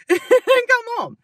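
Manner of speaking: high laughing finish. Real, not acted. Listing the kids' errands.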